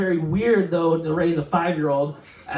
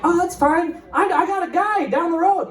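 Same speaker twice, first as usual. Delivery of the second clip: mom's voice; 12 semitones higher than usual. Retold or imitated. Mom's casual reply, retold.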